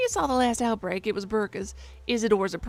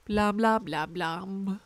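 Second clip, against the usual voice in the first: heartbeat imitation; 3 semitones lower, much slower than usual.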